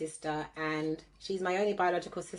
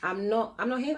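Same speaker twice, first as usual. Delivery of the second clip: usual speaking voice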